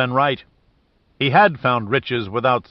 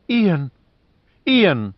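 high, louder cries of the husband's name; excited cry; 6 semitones higher, 3 dB louder than usual. Acted. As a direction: call out in sudden realization. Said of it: "she cried in sudden comprehension".

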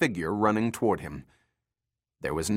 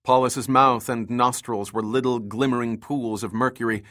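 ordinary delivery

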